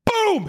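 high, explosive "boom"; imitated impact boom. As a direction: act out the blow landing. Imitates a blow to the face.

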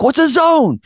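high-pitched, baffled question; retold clueless coach, squeaking upward; clueless repeat. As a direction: ask it again, voice shooting up. Repeats question to stunned team.

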